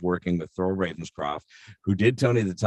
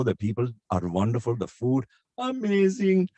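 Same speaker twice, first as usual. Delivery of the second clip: put-on Indian accent; 4 semitones higher than usual. Touring Europe and India in accents, Indian accent. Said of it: "do the tour of Europe".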